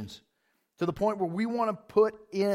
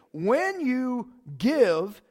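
slow emphatic quote; 4 semitones higher, much slower than usual. Acted. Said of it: "What does He say?".

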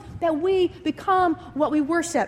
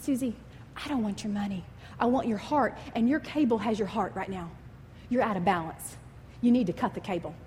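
lower, softer, firm and stern; stern voice of God; 5 semitones lower, 5 dB quieter than usual. Retold or imitated. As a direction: speak as God, gently stern. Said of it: "he says in a stern voice".

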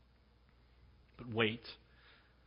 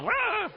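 excited squeal. Acted.

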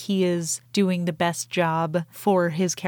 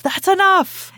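much higher, louder shouted order; acted shout; 12 semitones higher, 8 dB louder, slower than usual. Podcaster as Hux stopping the firing, shouting. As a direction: yell it to halt the firing.

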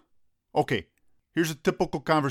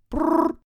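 high loud trilled ring; phone ring noise; 5 dB louder than usual. Shown as a demonstration. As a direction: roll the lips like a ringer. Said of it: "Okay, phone's ringing".

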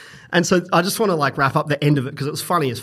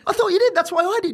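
high baffled; 14 semitones higher than usual. Retold or imitated. His own baffled reply.

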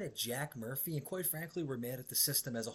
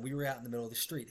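usual speaking voice

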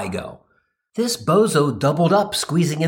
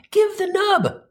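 much higher, mock-indignant demand; slower than usual. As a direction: deliver a high-pitched, exasperated retort. Acted demand in retold quarrel.

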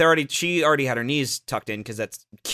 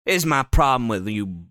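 normal talking voice

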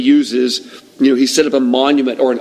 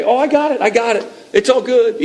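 much higher, mock-cheerful brush-off; falsely reassuring underling; 9 semitones higher than usual. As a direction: imitate an employee covering up for the boss. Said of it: "somebody underneath you telling you everything's okay".

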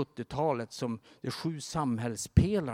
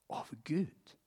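soft, hushed question; hushed awe; 8 dB quieter than usual. Retold or imitated. Stranded man asks Bruce Wilkinson, hushed awe. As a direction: ask it almost under your breath.

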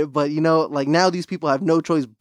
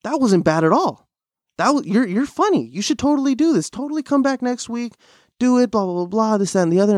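higher, upbeat encouraging voice; imitating another comic; 7 semitones higher than usual. Comic cheering him on after first set, higher excited voice. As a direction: pitch it up like a hyped fellow comic. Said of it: "And he goes".

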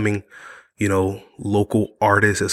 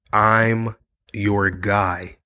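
very slow, deliberately drawn-out words; emphatic pledge; much slower than usual. Real, not acted. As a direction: stretch each word out for weight.

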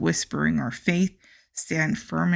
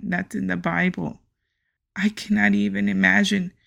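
ordinary delivery

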